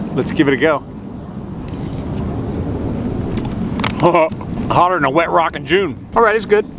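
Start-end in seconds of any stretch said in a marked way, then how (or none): 0.9-4.6 breathy laugh. Real, not acted. Laughs after first hot bite.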